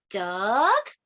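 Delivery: DUCK drawn out with a rising question tune. Acted. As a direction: ask "Duck?", sliding up at the end. Book guesses at each bird.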